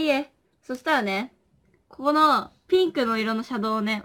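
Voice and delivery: ordinary delivery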